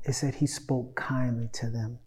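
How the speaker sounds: soft breathy whisper, almost no voice. Shown as a demonstration. Pastor hushing the verse for his congregation, whispered. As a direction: lean in and whisper the verse like a secret.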